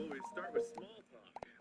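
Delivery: hushed whisper of the intro line. Real, not acted. Host retries the flubbed intro, whispering. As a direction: breathe it out barely audible.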